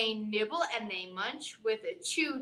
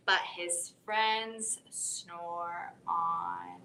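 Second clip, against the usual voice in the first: slow ending; 6 semitones lower, much slower than usual. A narrator's reading.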